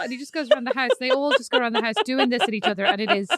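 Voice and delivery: normal talking voice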